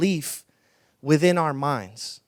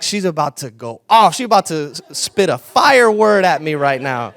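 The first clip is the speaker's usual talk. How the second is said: loud, laughing build-up; excited anticipation; 2 semitones lower, 10 dB louder than usual. Retold or imitated. Pastor bracing for the woman's prophetic word, loud and eager. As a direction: ham up the suspense. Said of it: "I'm like".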